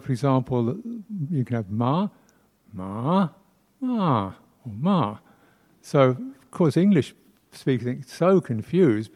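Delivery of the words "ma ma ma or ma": ma through the Thai tones. Shown as a demonstration. Teacher shows Thai tones on ma. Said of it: "you have the word".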